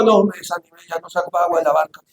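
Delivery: dropping soft and weary; quiet defeated refusal; discouraged mumble. Retold or imitated. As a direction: give up, too tired to bail. Discouraged disciple refusing to bail.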